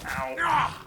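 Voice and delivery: high, loud "ow"; pained yelp. Real, not acted. Player hit by angry cannibals, pained yelp. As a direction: yelp as if just struck.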